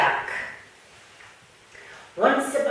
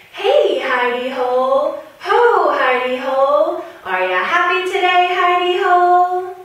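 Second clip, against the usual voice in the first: sing-song call, higher and louder; 9 semitones higher, 8 dB louder than usual. Acted. Rabbit calling to his hidey hole, loud sing-song call.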